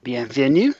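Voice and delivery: Bienvenue said the French way, nasal final vowel; French greeting. Real, not acted. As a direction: say Bienvenue like a New Hampshire sign in French. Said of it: "Don't judge, don't read too much into that".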